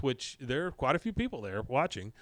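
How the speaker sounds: normal talking voice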